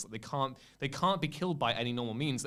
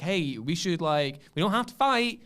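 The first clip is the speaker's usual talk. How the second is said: higher, louder earnest plea; pleading Cal; 7 semitones higher, 3 dB louder than usual. Retold or imitated. Cal pleading with Ravis, higher earnest plea. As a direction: beg him not to fight. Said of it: "He's like".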